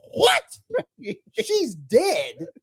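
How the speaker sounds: high, drawn-out shocked what; gasping disbelief as the twist lands; stunned disbelief. Retold or imitated. Viewer reacting to the daughter being dead, stunned and high. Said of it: "I was like".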